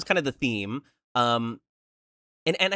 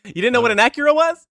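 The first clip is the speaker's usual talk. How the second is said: laughing incredulous question; 11 semitones higher, 9 dB louder, faster than usual. Real, not acted. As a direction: tease him through laughter. Teasing friend, laughing.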